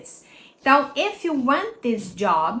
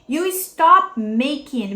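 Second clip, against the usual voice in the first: "stop" stressed, slow and a little louder; slow stressed demo; 3 semitones higher, 7 dB louder, much slower than usual. Shown as a demonstration. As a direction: lean on the word "stop". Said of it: "Viu que antes era stop speaking". ("stop" bem marcado e devagar)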